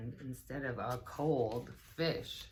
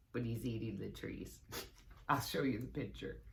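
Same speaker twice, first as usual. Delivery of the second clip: ordinary delivery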